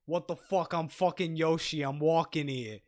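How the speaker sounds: loud Brooklyn street voice; Brooklyn tough-guy Yoshi. Pretend. Pitching a tough Yoshi, loud Brooklyn accent. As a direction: play Yoshi as a Brooklyn thug. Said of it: "That's the voice".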